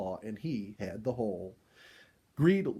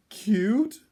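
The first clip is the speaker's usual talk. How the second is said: moaning disbelief; 9 semitones higher, 9 dB louder than usual. A narrator's reading. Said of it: "Dorothy moaned".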